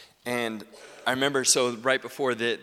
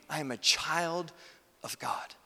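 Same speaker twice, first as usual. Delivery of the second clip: ordinary talk, nothing marked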